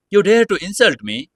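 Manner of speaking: high, loud furious challenge; enraged. Acted. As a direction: snap back in a rage. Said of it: "He lost his temper and said".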